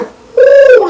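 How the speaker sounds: high, loud "ooh" of something flying off; whooshing "ooh". Real, not acted. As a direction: hoot a high swooping "ooh". Imitates mind flying away.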